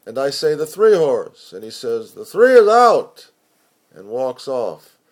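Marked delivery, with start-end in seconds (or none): 0.5-1.5 louder, higher reply; raised voice at the track; loud answer. Acted. Poet answers at racetrack. 2.1-3.3 high, loud and slow verdict; loud scornful dismissal; loud dismissive. Acted. Stranger dismisses the pick.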